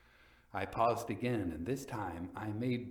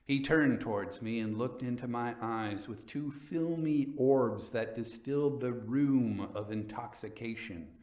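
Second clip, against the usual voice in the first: ordinary delivery, nothing marked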